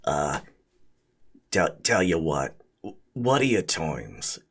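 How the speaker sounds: low spaghetti golem voice. Acted. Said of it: "and he, uh, says".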